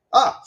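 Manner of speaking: sharp, high, loud "Ah!" of surprise; startled yelp. Real, not acted. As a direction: yelp at the sudden glitch. Sudden glitch on stream.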